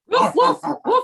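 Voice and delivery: high yappy barks; playful mock barking; dog barking. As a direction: bark like an excited little dog. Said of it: "let's hear some barking".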